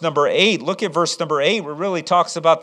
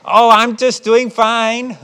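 higher, slow, drawn-out fake cheer; put-on friendliness; 5 semitones higher, 4 dB louder, slower than usual. Parent hiding frustration from the caller, put-on friendliness. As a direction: stretch it out as a hammed-up pleasant act. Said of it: "we can put an act on in front of people".

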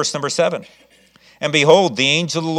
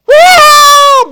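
high loud whoop of joy; excited whoop; 17 dB louder than usual. Acted. Rhoda hearing Peter's voice, whooping with joy. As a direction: let out a burst of delight. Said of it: "she's all excited".

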